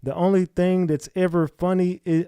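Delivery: normal talking voice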